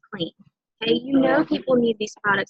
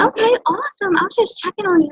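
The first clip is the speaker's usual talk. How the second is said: upbeat reply; 8 semitones higher than usual. Retold or imitated. Voicing the seller's reply.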